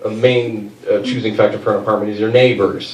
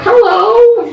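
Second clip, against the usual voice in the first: put-on sudden high chirpy old lady greeting, much higher; shrill old lady hello; 3 dB louder than usual. Comic mimics the elderly neighbor popping out, high chirpy hello.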